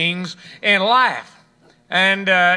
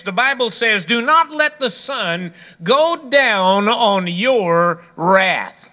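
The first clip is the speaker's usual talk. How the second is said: preaching; 4 semitones higher than usual. Acted. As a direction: drive the point home to the congregation. Preaching at full force.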